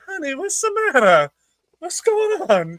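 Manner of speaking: very high, hammed-up sweet concern; mock concern. Boyfriend coming to help her, high mock concern.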